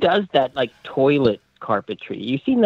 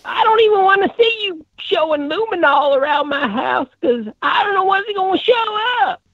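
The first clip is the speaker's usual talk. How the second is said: high-pitched mock Southern rant; comic Southern voice at a very high pitch; Southern drawl. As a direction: keep up a hammed-up Southern accent, way up high. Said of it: "It was a Southern drawl".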